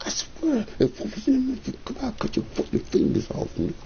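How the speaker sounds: high, hushed seething threat. Real, not acted. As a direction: spit it out under breath.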